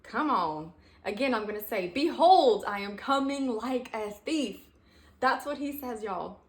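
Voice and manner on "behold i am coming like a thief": BEHOLD shouted out loud and high; exclamation mark. Shown as a demonstration. Redoing the verse for the listeners with full exclamation. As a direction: proclaim it like it ends in an exclamation mark. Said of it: "Exclamation mark".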